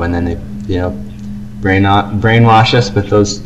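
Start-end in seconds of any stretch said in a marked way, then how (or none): none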